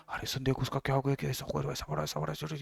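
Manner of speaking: barely audible, quick hushed gossip; hushed murmur. Shown as a demonstration. Teacher demos a zero dB sound with a hushed murmur. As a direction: murmur it like a secret so nobody overhears. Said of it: "जो हम धीरे से ये जो बोल रहे थे ना".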